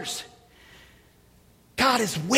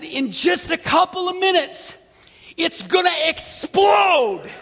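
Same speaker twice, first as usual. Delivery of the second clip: screaming over music; 6 semitones higher than usual. Retold or imitated. Preacher warning the woman, laughing shout. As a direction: yell the warning, half laughing. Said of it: "had to scream in her ear".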